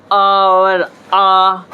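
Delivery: आ held very long and slow; drawn-out aa. Shown as a demonstration. Teacher stretching aa to show the hour sound. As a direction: let the आ ring out slowly. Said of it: "ओ कैसे इस्तेमाल करेंगे?".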